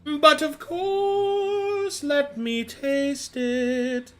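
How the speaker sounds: high, slow sing-song voice; sing-song lady. Acted. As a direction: drawl it out like a grand lady. GM voices the missus, sung lilt.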